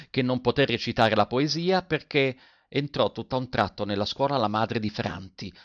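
usual speaking voice

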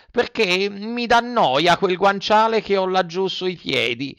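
put-on high, slow childish whine; fussy Pinocchio. Puppet blames the pillow to avoid medicine, whining. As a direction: make a drawn-out, high-pitched excuse.

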